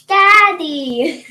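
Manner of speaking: put-on loud, shouted Daddy like a little kid; retold child's scream. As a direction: yell it like a kid calling for his dad. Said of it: "And he's screaming".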